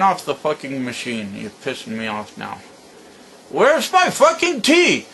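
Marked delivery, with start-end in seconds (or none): 3.5-5.1 slow, much higher, louder demand; demanding shout. Real, not acted. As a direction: bellow it out, fed up. Demanding tea from grandson.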